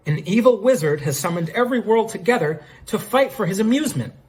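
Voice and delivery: high, theatrical big reveal; dramatic declaration. Acted. Phoenix Wright reveals what happened to everyone, dramatic proclamation. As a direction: proclaim it like a grand conclusion. Lawyer announces his grand conclusion.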